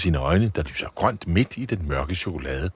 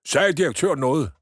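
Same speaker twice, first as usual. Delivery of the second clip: polite question; 9 semitones higher, 3 dB louder than usual. Acted. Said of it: "spørger Nikolajsen høfligt".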